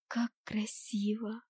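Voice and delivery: slow, soft whisper of wonder; hushed admiration. A narrator's reading. Girl admires the tiny northern lights, whispered admiration. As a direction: breathe it out in awe, drawn out. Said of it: "восхитилась я".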